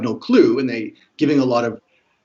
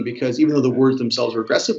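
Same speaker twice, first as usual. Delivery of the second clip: normal talking voice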